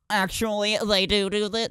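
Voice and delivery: the tone he did not mean, snooty correction; know-it-all correction. Shown as a demonstration. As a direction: play the pedant correcting someone. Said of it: "That, that's not what I meant to do".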